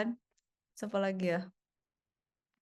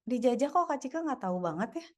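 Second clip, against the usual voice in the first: ordinary talk, nothing marked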